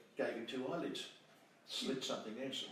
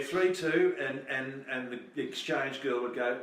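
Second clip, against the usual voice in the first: usual speaking voice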